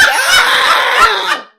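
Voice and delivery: very loud high drawn-out scream; mock scream. Retelling the coaster ride with dad, screaming. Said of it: "whooping and hollering".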